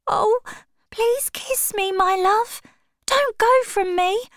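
very high, tearful begging, slowing at the end; a heartbroken girl begging at his feet; crying plea. Acted. Sybil begging Dorian not to leave her, high and tearful. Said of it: "A strange cry came from her".

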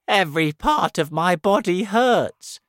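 high, loud whining earthworm; loud pained complaint. A narrator's reading. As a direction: complain loudly about aches and pains. Earthworm complains of pain after crash.

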